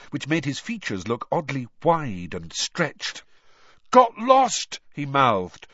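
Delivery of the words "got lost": high, loud, panicky burst; panic-stricken. A narrator's reading. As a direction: blurt it out in a panic. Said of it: "looking panic-stricken".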